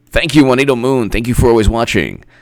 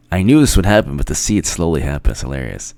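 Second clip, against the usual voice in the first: usual speaking voice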